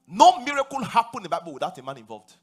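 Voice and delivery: ordinary talk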